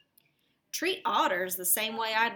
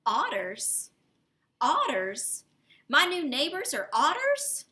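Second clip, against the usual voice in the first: rising, louder and higher in disbelief; surprised rabbit; 4 semitones higher, 4 dB louder than usual. Acted. Rabbit reacting to news of otter neighbors, rising disbelief. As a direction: repeat it with growing alarm.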